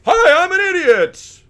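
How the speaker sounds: very high, loud and drawn-out mock idiot; goofy self-mocking squeal. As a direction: shout it slowly in a silly high voice. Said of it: "it's like you just like".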